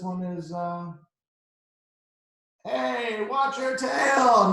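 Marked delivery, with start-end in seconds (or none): 2.6-4.5 high, loud slow warning; warning shout. Acted. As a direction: call out loudly and stretch it. Kid warning the dinosaur.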